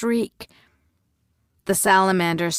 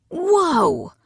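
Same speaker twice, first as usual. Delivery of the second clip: sharp high cry of alarm; high, startled whoa; startled gasp; 12 semitones higher, 5 dB louder than usual. A narrator's reading. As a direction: jump at the sight. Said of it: "Nari gasped".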